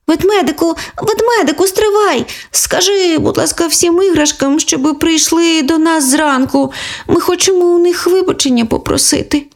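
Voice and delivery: put-on high childlike call after the bear; calling to the teddy to wait; little girl calling, pitched high. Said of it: "вигукнула Оленка".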